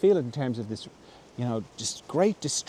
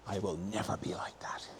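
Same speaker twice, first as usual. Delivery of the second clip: whispered vow; 4 semitones lower, 11 dB quieter than usual. Retold or imitated. Child vowing to self.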